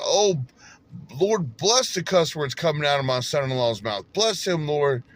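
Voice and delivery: high, loud, fervent imitation of mom praying; mother's pleading prayer. Son retelling his mother praying over his cursing, high loud plea. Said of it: "my mother said".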